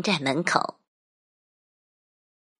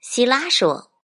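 ordinary delivery, nothing marked

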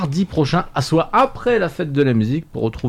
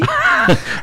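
short squeaky laugh; a quick high giggle; high burst of laughter. Real, not acted. Laughs at recipe slip.

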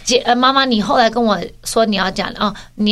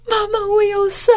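put-on high, crying child's voice, much higher; whiny sobbing kid pleading her case; tearful little girl; 12 semitones higher than usual. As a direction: sob it out as the wronged little sister. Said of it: "崩泪这样子说".

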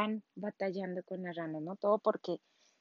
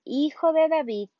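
shouted plea, much louder; woman in the street shouting after Jesus, loud plea; crying out; 6 semitones higher, 11 dB louder than usual. Retold or imitated. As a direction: call out to be heard over the crowd. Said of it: "Y nuevamente".

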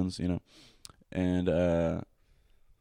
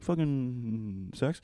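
drawn-out, very slow "fucking sex"; deadpan answer; 4 semitones higher, 4 dB quieter, much slower than usual. Retold or imitated. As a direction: stretch every word out lazily. Answering what he wants.

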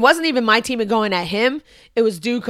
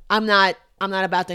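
usual speaking voice

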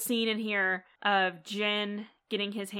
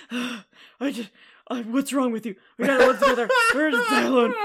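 breathless Jack impression; 3 semitones higher, 8 dB louder than usual. Imitating Jack out of breath while arguing, hammed-up breathy. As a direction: huff through every word like Jack. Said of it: "he's always like".

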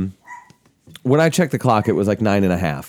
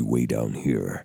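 much lower, softer Batman demo; 13 semitones lower, 7 dB quieter, slower than usual. Shown as a demonstration. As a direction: pull the voice way down into the throat. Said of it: "Kevin Conroy Batman".

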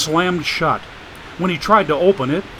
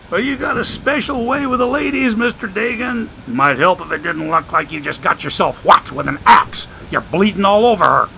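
put-on higher, sly voice of an old drifter; streetwise homeless man; 6 semitones higher, 4 dB louder than usual. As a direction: play the wisecracking drifter, amused. Joshua's voice in the story.

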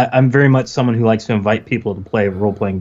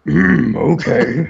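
dragon voice; 5 semitones higher, much slower than usual. Retold or imitated. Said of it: "take it down two octaves".

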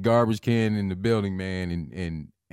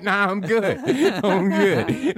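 high, laughing mock reassurance; laughing drunk brush-off; 11 semitones higher, 3 dB louder, slower than usual. Drunk man laughing off the cops, high and giggly. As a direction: brush them off through giggles.

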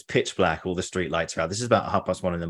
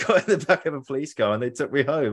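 normal talking voice

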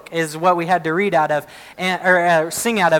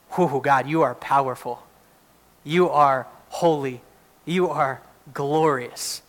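awed prayer; 5 semitones lower, 4 dB quieter than usual. Acted. Voices the prophet's awed prayer.

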